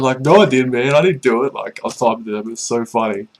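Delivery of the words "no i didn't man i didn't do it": louder, higher protest of innocence; mock denial. He protests to the lurers, louder and higher. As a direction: insist loudly it wasn't you. Denying he tipped them off.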